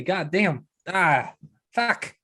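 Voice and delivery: high, flustered barkeep voice; stumbling over an eager offer; rattled barkeep. Acted. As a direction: blurt it out, overwhelmed and swearing.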